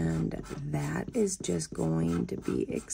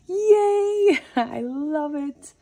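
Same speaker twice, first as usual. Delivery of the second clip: squealed yay, much higher and louder; delighted yay; 10 semitones higher, 10 dB louder, much slower than usual. Real, not acted. Thrilled at her scratch-off pick, squealing yay. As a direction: burst out with real delight.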